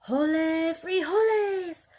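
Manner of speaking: high, slow triumphant battle cry; battle shout. A narrator's reading. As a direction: shout it as you strike. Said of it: "Skipito shouted".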